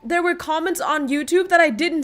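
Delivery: high, loud disbelief; startled outburst as the truth dawns; real high-pitched bewilderment; shocked. Real, not acted. Discovers a missed chat mid-stream.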